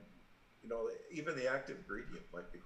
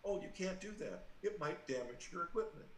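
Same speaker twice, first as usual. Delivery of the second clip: lightly mimicked customer-service caution, pitched higher; mock support agent; 7 semitones higher than usual. Internet support refusing a customer's reset request, mock cautioning. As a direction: imitate a fussy agent's excuse, higher voice.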